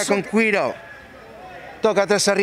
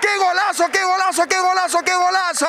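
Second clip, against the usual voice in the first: breakneck goal commentary; 6 semitones higher than usual. Real, not acted. Goal called in live commentary.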